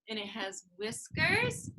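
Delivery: ordinary talk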